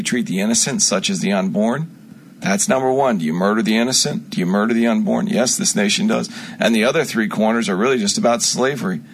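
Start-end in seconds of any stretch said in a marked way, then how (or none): none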